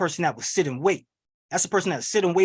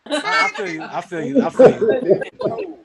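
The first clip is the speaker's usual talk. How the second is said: laughing; 10 semitones higher, 6 dB louder, slower than usual. Real, not acted. Laughing at crowd shouts.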